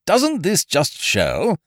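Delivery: slow, portentous question; solemn. A narrator's reading. Ukridge moralizes to his friend, slow and solemn. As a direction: draw it out like a sermon. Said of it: "said Ukridge solemnly".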